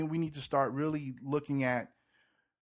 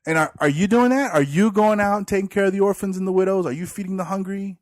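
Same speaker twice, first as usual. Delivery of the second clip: louder, pointed sarcastic questions; sarcastic challenge; 7 semitones higher, 11 dB louder than usual. Retold or imitated. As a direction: needle him with sarcasm. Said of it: "I'm a very sarcastic person".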